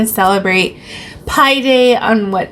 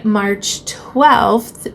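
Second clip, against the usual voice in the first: usual speaking voice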